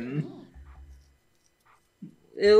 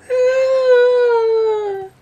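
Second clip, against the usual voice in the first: imitating her gasp; 13 semitones higher than usual. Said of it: "I love how she's like".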